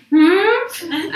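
high, loud questioning hum; re-enacting a startled little "hmm?"; squeaked hum of feigned surprise; mock-innocent "hmm?". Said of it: "And I was like".

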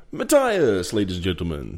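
hammed-up awards-show sign-off; mock presenter announcement. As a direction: hand over like a stage presenter. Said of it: "and he went".

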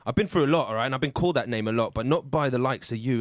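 normal talking voice

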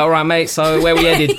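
matey Cockney cabbie greeting with a laugh; Cockney accent. Shown as a demonstration. Cabbie mirroring a Cockney passenger, Cockney accent. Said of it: "Or if it's a Cockney guy".

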